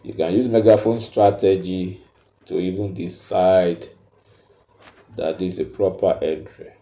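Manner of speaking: usual speaking voice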